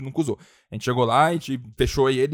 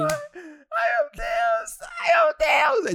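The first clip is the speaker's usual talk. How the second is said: lamento agudíssimo e arrastado; desespero encenado; much slower than usual. Acted. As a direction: gemer em falsete, como em choro exagerado. Pavor encenado com a lembrança. (desespero fingido, quase chorando)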